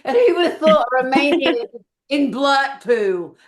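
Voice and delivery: lightly mimicked Romanian-in-Blackpool accent; teasing put-on Blackpool-Romanian accent; imitated mixed accent. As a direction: play up a mix of Romanian and Blackpool accents, loud and high. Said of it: "What a brilliant mix of accents".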